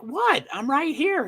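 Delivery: high-pitched voice for a confused dog; high, bewildered pet impression; puzzled dog voice. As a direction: squeak it out like a baffled pup. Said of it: "Is that the right voice inflection?".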